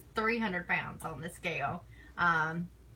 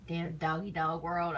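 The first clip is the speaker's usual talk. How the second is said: ordinary talk, nothing marked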